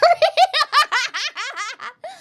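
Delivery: loud, high-pitched laughing fit; high burst of laughter. Real, not acted. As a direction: crack up out loud.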